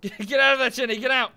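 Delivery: loud warning to a teammate; urgent shout. Real, not acted. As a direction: shout him out of danger.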